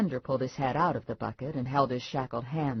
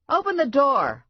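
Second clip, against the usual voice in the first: much higher, loud banging-on-the-door shout; 12 semitones higher, 9 dB louder than usual. A narrator's reading. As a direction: yell it through the door at the fugitive. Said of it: "I shouted".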